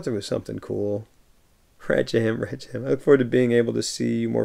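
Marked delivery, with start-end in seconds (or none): none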